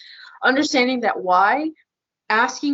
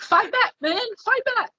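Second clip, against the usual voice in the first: high-pitched exasperated goading; retold frustrated urging, voice jumping way up; angry; 12 semitones higher than usual. Angry wife goading her calm husband, high and exasperated. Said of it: "And I was angry 'cause I'm like".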